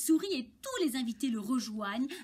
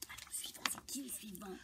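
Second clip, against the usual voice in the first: very soft, whispered question; barely voiced, hushed asking; whispering; 4 semitones lower, 15 dB quieter, faster than usual. Real, not acted. Reader asks who dives next, whispering.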